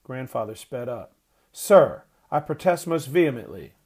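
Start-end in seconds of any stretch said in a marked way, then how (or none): 1.5-3.8 loud indignant shout, higher; outraged old man shouting; loud protest. A narrator's reading. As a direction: demand he stop at once. Grandfather confronts the cart man.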